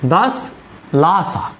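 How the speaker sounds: "दस लासा" split by a clear pause; word by word. Shown as a demonstration. Teacher dictating what to read, "दस लासा" in two separated parts. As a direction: say das, pause, then laa-saa for "दस लासा". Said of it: "इसको पढ़िए दस लासा".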